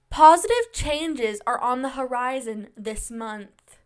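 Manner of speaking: loud, slow, emphatic announcement; proclaimed fortune reading. Real, not acted. Host giving Spotify listeners their reading, proclaimed. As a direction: read it out slowly, punching the opening.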